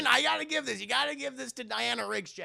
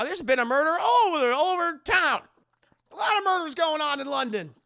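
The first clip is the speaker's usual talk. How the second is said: loud, high hammed-up exclaiming; acted flustered landlady; 8 semitones higher, 7 dB louder, faster than usual. Landlady answers Ellie about murders, loud mock bluster.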